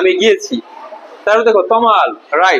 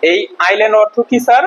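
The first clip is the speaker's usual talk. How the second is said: usual speaking voice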